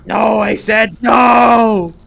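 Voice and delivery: slow, high, loud no; shouted refusal. Acted. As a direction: yell the refusal in full. Refusing the hag's cookies.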